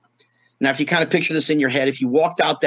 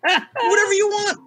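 imitating Richard Pryor. Richard's quote, urging him on.

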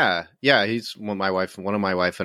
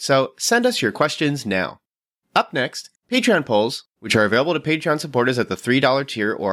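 higher, animated scripted host read; podcast announcement read; 4 semitones higher than usual. Acted. Host telling listeners about mailbag and Patreon, announcer read. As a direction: read the show notes briskly and clearly to listeners.

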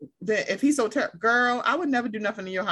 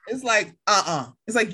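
normal talking voice

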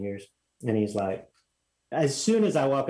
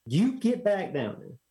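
fuming; 8 semitones higher than usual. Retold or imitated. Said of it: "he's kinda fuming".

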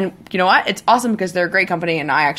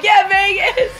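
excited yell; 12 semitones higher, slower than usual. Retold or imitated. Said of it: "Screaming in our hotel room".

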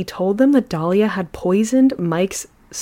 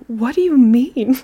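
higher, baffled retelling of his reply; mock exasperation; 6 semitones higher, 4 dB louder than usual. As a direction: imitate an exasperated husband. Husband's reply to her whining.

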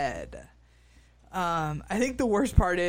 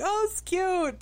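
high-pitched mock cooing; much higher, lightly imitating her amusement; imitated delighted reaction; 12 semitones higher than usual. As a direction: put on a high, pleased voice. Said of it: "like, laughing and be like".

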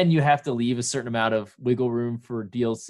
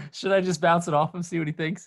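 laughing; 5 semitones higher than usual. Real, not acted. Laughing while joking.

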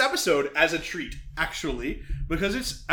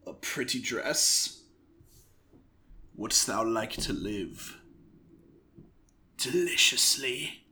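low, slow, soft tempter; intro character's line; 8 semitones lower, 6 dB quieter, slower than usual. Acted. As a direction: offer it slowly in a deep, quiet voice. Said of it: "you're just gonna do the".

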